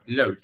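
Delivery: "load" with a long o and soft final consonant. Shown as a demonstration. Teacher models the word for students, like "load". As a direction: say it nearly as L-O-A-D, one syllable. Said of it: "Phát âm gần như là L O A D".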